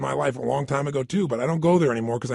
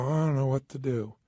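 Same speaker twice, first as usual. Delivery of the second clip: defeated mumble; 2 semitones lower, 5 dB quieter than usual. Shown as a demonstration. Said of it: "than if you go".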